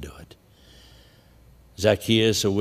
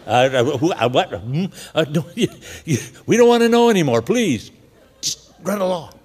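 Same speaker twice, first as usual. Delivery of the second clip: flustered men; 5 semitones higher, 3 dB louder than usual. Retold or imitated. Men embarrassed, stammering.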